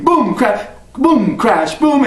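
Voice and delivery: booming crash sounds; imitated thunder. Teller imitates the crashing thunder, booming. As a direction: boom it out like thunder.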